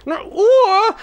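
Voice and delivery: shrieked high and loud, rising: "Eine Uhr?"; mock outraged yell; shouted question. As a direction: scream the question again. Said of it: "Schrei mich nicht so an".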